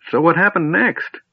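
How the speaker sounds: put-on high, insistent old-man voice; demanding old man. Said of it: "The old man demanded".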